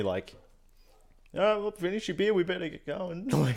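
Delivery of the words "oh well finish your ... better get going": high, casual mate voice; a light mock of a mate cutting out early; imitating his mate. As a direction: pitch it up, breezy and offhand. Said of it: "Max is standing next to me".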